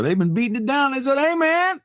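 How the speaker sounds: hammed-up, climbing voice of insistent fans; mock clamor. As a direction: build to a high-pitched pleading call. Acts out clamoring listeners, building.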